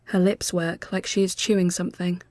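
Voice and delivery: plain reading voice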